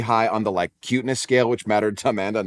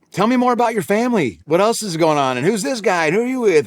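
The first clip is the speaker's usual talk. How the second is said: higher, eager deflecting questions; brightly steer the talk away from yourself; dodging the question; 10 semitones higher, 4 dB louder than usual. Retold or imitated. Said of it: "trying to dodge it".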